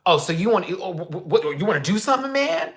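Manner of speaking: high, loud imitation of a man squaring up; mock challenge. Bike man daring the narrator to fight, high mock challenge. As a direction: puff up like you want a fight. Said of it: "bike man challenging".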